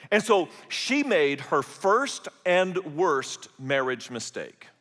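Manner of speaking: usual speaking voice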